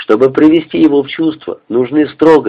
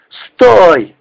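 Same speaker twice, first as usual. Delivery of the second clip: drawn-out, higher cry to halt; urgent shout; 5 semitones higher, 4 dB louder than usual. A narrator's reading. Narrator grabbing his comrade at the parapet, urgent shout. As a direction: call out sharply to stop him.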